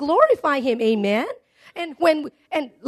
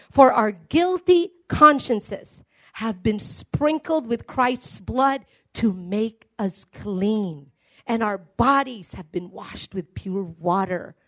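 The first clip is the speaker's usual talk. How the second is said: slow scripture reading; 4 semitones lower, slower than usual. Acted. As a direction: let the verse sink in, unhurried and hushed. Reads scripture aloud slowly.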